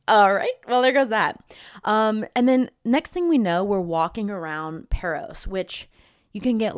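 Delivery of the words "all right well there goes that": mock disappointed. Disappointed at no proposal.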